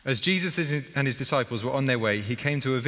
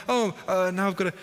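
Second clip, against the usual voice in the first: fretting Martha; 6 semitones higher than usual. Retold or imitated. Voicing Martha cooking.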